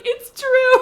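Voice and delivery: high, laughing burst of joy; laughing, emotional. Real, not acted. Telling listeners how lucky she feels in love, laughing high. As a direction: laugh it out, overwhelmed with happiness. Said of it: "I'm getting emotional thinking about it".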